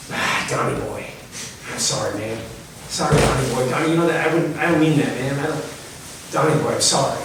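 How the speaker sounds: put-on a touch of a remorseful drunk on a voicemail; mumbled apology. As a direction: mumble sorry like you can't look him in the eye.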